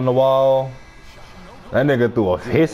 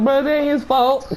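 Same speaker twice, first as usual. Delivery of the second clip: high protesting quote; 10 semitones higher than usual. Retold or imitated. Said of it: "I was like".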